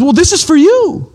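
as if he expected you all along; a lightly voiced patient king; throne man's welcome. Man on throne offers the feast.

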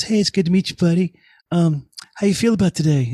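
a hint of Mickey greeting the new guy; mild impression of a friendly celebrity; imitating Mickey. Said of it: "Mickey looks at me seriously and goes".

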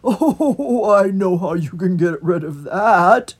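loud, jolly toad voice. A narrator's reading. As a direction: proclaim it cheerfully. Toad speaks.

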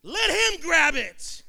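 high, loud, drawn-out shout; punchy shouted sermon line; preacher's exhortation. Real, not acted. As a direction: proclaim it slowly at full voice.

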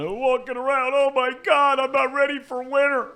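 high whiny mock complaint. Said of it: "So he's soft".

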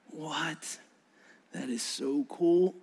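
breathless, whispered amazement; awed whisper. Real, not acted. Preacher marvelling at Jesus' purpose, soft whisper. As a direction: hush it out in wonder.

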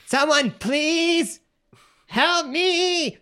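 very high, drawn-out squeaky plea; tiny trapped pleader. Pretend. Felix begging from inside the gem, tiny high wail. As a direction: beg for rescue in a miniature voice. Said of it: "miniature Felix".

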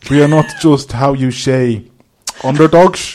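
halting fake Dutch English with slushy S; hammed-up Dutch-accented English; Steve McLaren Dutch accent. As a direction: imitate an Englishman putting on Dutch. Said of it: "Steve McLaren Dutch".

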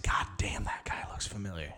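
whispered aside, voiceless and hushed. Retold or imitated. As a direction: whisper it so nobody nearby hears. Buddy in bar, quietly noticing.

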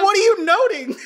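high, loud, laughing incredulity; mock outrage. Host challenging co-host's notes, loud mock outrage. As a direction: burst out in teasing disbelief.

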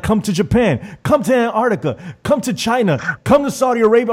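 high-pitched pleading callers, imitated; imitating eager fans. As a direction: mimic a chorus of insistent invites. Voicing fans begging him to visit.

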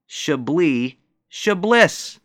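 "Chablis Chablis", slow, second as "sha-BLISS"; mock mispronunciation. Mocking the manager's wine talk, slow botched "Chablis". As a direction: drag out "Chablis" the right way, then with a hard s. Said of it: "she went on to pronounce".

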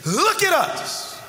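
high, slow commanding call of Peter; commanding demand. Acted. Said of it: "There's some emphasis there".

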